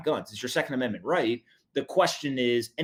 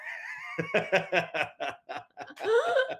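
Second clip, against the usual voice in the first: high laughing burst; 4 dB quieter than usual. Real, not acted.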